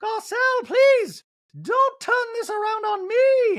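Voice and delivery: high, loud, drawn-out pleading; slow, theatrical high-pitched protest; Dorit. Retold or imitated. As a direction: plead in a hugely exaggerated high voice. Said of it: "And Dorit's like".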